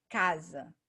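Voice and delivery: casa said clearly with an S that sounds like Z; demonstrating casa. Shown as a demonstration. Teacher sounding out casa for the class. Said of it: "eu vou botar aqui pra vocês poderem ver".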